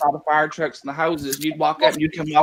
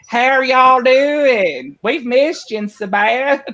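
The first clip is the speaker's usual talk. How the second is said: high, slow, hammed-up Southern welcome; slow, high, exaggerated country greeting; thick Southern accent; 8 semitones higher, 4 dB louder, much slower than usual. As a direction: lay the hillbilly drawl on thick for the visitors. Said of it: "we just turn it up".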